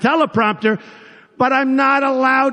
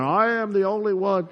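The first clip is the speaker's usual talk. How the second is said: softer mock Shakespearean declamation; Cruz as Shakespeare; 6 dB quieter than usual. Candidate mocking rival's simple statement, hushed mock-Shakespeare delivery. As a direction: quietly intone it like a stage Shakespearean. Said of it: "everything's always Shakespeare".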